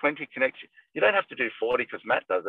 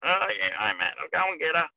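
put-on raspy old man voice; 5 semitones higher than usual. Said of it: "he's sort of got a really raspy voice".

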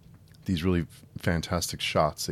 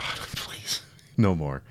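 exasperated whispered please; laughing whispered plea; much slower than usual. Real, not acted. Begging director to drop slow motion.

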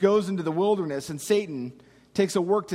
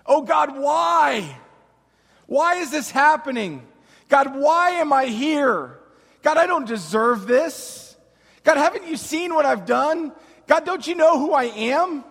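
loud, raised, accusing plea to God; desperate cry; 4 semitones higher, 4 dB louder than usual. Acted. Desperate believer asks where God is, crying out. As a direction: cry out louder and higher, demanding answers. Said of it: "to cry out".

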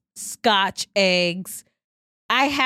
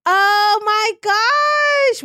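shocked; 12 semitones higher, 7 dB louder, much slower than usual. Real, not acted. Shocked mother listens.

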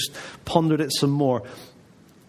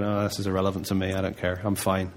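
quick, lower, mumbled brush-off; dismissive listener; 6 semitones lower, 4 dB quieter, faster than usual. Retold or imitated. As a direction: toss it away quickly, unbothered. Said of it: "you might be sitting here going".

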